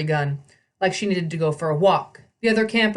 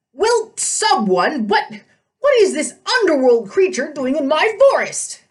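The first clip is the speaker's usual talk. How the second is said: put-on high, indignant old satyr voice; outraged old goat-man shouting; fussy old satyr; 8 semitones higher, 5 dB louder than usual. A narrator's reading.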